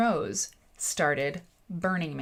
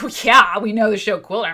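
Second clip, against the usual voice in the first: loud, mock-incredulous agreement; emphatic crowd reply; 4 semitones higher, 12 dB louder than usual. Everybody at Burning Man answers the newcomer, emphatic agreement. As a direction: belt it out as the imagined crowd.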